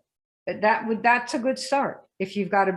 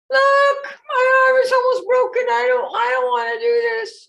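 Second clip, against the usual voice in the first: much higher, louder whining complaint; whiny child; 7 dB louder than usual. Retold or imitated. Kid whining to mom about a tiny scratch, exaggerated whine. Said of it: "tell your mom or whoever".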